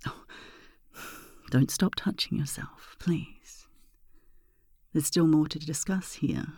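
sultry ASMR. Acted. Intimate ASMR seduction talk.

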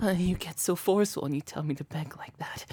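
hushed breathy confession; breathy whisper. Acted. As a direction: confide it in a whisper. Confiding whisper.

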